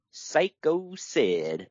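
very slow, drawn-out name announcement; mock announcer's slow build; dramatic reveal. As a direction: stretch it out like a big reveal. Dramatic reveal of new bodyguard.

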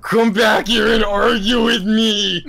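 high, loud, slow shout; yelled quote. Retold or imitated. Pseudo father yelling in a high, slow shout to call Pickles back. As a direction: yell it after someone who walks out. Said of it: "he's like".

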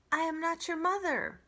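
steady, patient robot; a hint of a calm robot. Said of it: "robot repeats".

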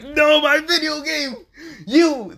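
high, loud, stretched wail over the game; anguished outburst. Acted. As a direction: cry out as if your treasure just broke. He's upset over broken game.

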